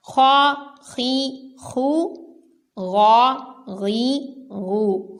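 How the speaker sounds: خا خی خو and غا غی غو full and heavy; teacher drilling heavy خ and غ; kha and ghain drill. Shown as a demonstration. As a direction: rasp each خ and غ syllable fully. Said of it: "خا اور غین کو پر پڑھنا ہے".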